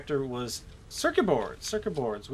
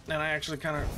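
usual speaking voice

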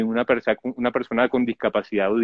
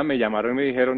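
normal talking voice

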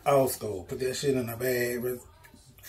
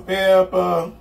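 "pepper" echoed higher and louder, half sung; sing-song echo; 5 semitones higher, 7 dB louder than usual. Shown as a demonstration. Seasoning chicken for the camera, singsong echo. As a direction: lift "pepper" up into a playful singsong.

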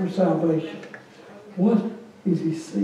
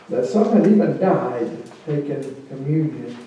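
usual speaking voice

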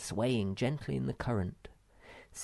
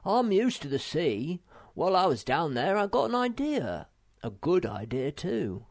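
put-on lightly raised, cheerful young troll voice; a touch brighter and louder, pleased with himself; Moomin Troll; 6 semitones higher, 5 dB louder than usual.

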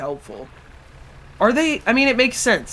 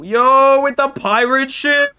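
loud, high, thrilled shout; excited exclamation; 6 semitones higher, 6 dB louder, slower than usual. Real, not acted. Gamer spotting the pirate ship, excited. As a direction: burst out in real amazement.

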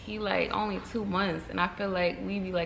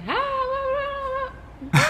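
put-on very high, wordless baby babble; imitated baby gibbering; 4 dB louder than usual. Mother showing how her baby yells, imitated gibbering. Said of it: "start gibbering".

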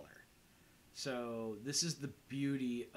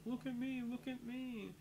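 much higher, whiny mock Dante voice; imitating Dante; 13 semitones higher than usual. Retelling Dante's complaint to Randall in a high mock voice. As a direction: squeak it out as a hammed-up, wounded Dante. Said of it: "Dante's like".

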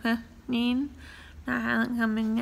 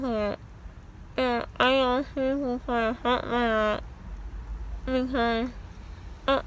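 talking around gauze; gauze in mouth. Real, not acted. Seen 3: Gauze strips hang from mouth throughout; absent in reference frames.

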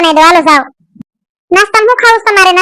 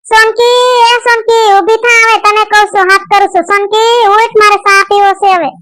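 higher, sing-song call to wake her; 5 semitones higher than usual. Acted. Mother-in-law calling Sonki to make her tea, higher sing-song call.

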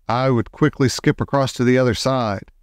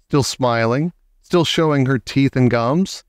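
plain reading voice

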